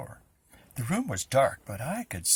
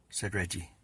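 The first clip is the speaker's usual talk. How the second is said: plain reading voice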